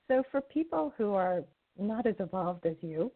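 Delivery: ordinary talk, nothing marked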